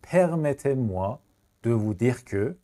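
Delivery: slow chunked repeat; very slow, split repeat. Shown as a demonstration. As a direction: break it into two slow chunks.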